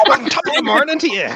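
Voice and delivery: high-pitched, hammed-up Irish greeting; joking fake Irish brogue; mock stage Irish. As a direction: parody a bad Irish accent. Reprises mocked stage Irish greeting.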